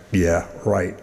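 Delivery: low, soft, dismissive aside; deadpan doubter; dry disbelief. Acted. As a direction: brush it off skeptically under the voice. Skeptical reply to talking dog.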